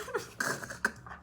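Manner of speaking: high airless giggle; breathless laugh. Real, not acted. Reader laughing at herself mid-reading, breathless giggle. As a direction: laugh without voice. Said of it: "I'm so emotional".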